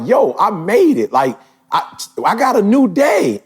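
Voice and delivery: thrilled to be alive another day; excited. Real, not acted. Joy at waking up blessed.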